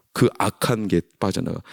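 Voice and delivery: normal talking voice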